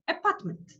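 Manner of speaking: APARTMENT with quick, weak unstressed vowels; weak schwa. Shown as a demonstration. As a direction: say Apartment with barely sounded schwas. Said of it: "you pronounce very fast".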